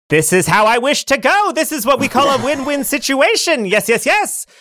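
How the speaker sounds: high, hammed-up lament; melodramatic brother. Sparrow mourns his brother, high and hammed-up. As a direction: wail woe like a stage hero.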